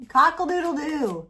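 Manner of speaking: loud high mock cock-a-doodle-doo; hammed-up rooster call; rooster crow.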